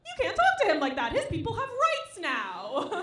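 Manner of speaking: high, loud, fast outrage; hammed-up righteous protest; mock indignation. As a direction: defend him indignantly. Her indignant defence.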